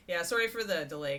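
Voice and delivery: normal talking voice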